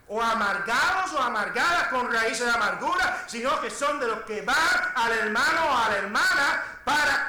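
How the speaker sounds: loud, high pulpit cry; full-force preaching. Acted. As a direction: stretch the final words out forcefully. (predicación exaltada a plena voz)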